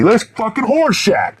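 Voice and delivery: high, slow surprised recognition; light up as you spot him; recognizing Horshack. Retold or imitated. Fan in the theater spotting Horshack, high and slowly surprised. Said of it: "I noticed it immediately".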